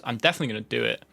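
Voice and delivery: rushed, words run together; fast slurred. Shown as a demonstration. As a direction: let the words blur fast. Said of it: "I sort of slur my words".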